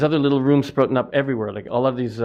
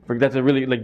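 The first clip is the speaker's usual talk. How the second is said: ordinary delivery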